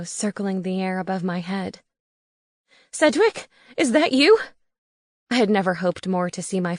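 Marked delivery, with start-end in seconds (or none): 2.9-4.4 hopeful call. A narrator's reading. Hopeful call to owl above.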